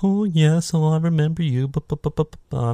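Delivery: high, sing-song lovey babble; mock romantic gushing. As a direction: gush it mockingly, fading into babble. Mocking a romance scene.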